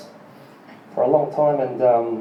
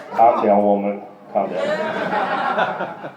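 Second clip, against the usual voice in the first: mock calming; 8 semitones lower, much slower than usual. Real, not acted. Hushing a noisy audience member.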